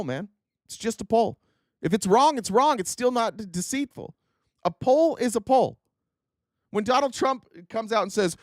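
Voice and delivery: normal talking voice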